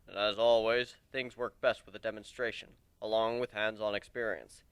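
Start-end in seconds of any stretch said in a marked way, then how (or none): none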